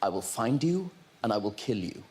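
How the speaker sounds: menacing threat. Acted. Thriller villain threat, low voice.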